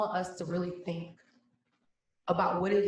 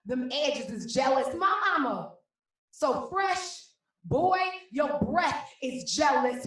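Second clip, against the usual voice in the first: loud, higher, rhythmic slam delivery; spoken word poem; 9 semitones higher, 8 dB louder than usual. Acted. Poet performing for her mom on Juneteenth, loud and rhythmic.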